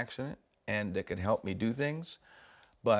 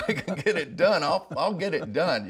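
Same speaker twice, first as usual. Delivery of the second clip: laughing confidence; 6 semitones higher, 5 dB louder than usual. Retold or imitated. Retells his confident answer.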